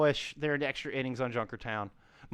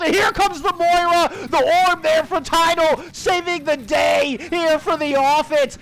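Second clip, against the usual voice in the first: raised, loud esports call; excited live play-by-play; 8 semitones higher, 7 dB louder than usual. Real, not acted. Caster calls the final fight for viewers, shouting excitedly. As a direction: build the frantic fight into big hero moments.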